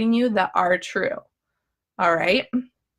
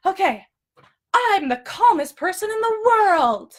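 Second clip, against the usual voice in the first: very high, falsely serene self-talk; hammed-up, unconvincing affirmation; unbelievable calm; 10 semitones higher than usual. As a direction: pretend calm in a pitched-up voice. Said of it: "there's no way you're gonna believe that".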